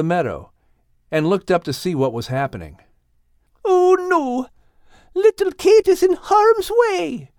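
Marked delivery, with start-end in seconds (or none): 3.6-7.3 high, alarmed character cry; shouted. A narrator's reading. Al shouting that little Kate is in danger. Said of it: "shouted Al".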